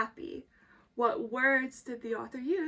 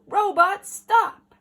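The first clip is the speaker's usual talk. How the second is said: higher, louder command shout; dad's shout; 8 semitones higher, 15 dB louder, slower than usual. Acted. Dad shouting at the robot to stop, higher and louder. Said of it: "till I shout".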